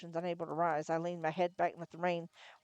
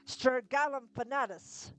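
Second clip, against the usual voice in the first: high, slow cry of a spell; spell incantation; 7 semitones higher, slower than usual. Acted. Wizard hurling a counterspell at the image, high and slow. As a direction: call the spell out with force.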